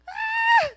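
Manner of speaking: sudden high shriek at the cat; a loud, high scream of fright; startled scream. Retold or imitated. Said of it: "And I'm like".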